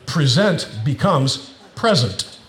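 first "present" stressed pre-SENT, second PRE-sent; shifting syllable stress. Shown as a demonstration. Pastor to church, showing the two stresses of present. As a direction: contrast pre-SENT with PRE-sent, slowly. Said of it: "if you put the emphasis on a different syllable".

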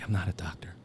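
retold whisper, half-voiced; a retold barracks whisper; hushed whisper. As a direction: whisper so the bay stays asleep.

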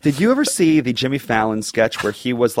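ordinary talk

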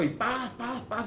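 high mock shooting noises; imitated gunshots. Speaker mimics shooting at people, high playful gunshot sounds. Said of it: "empieza a disparar".

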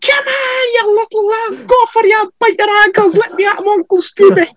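very high, strained accent impression; hammed-up high-pitched impression with a thick accent; Keith Cook. Friends mimicking Keith in a German sauna, exaggerated accent. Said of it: "doing the Keith accent".

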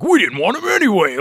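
high, loud, smug mocking voice; mock James Dolan. Mocking the Knicks owner in a high smug voice. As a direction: sneer it like a petty team owner. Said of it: "James Dolan was trying to do to be like".

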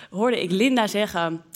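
ordinary delivery

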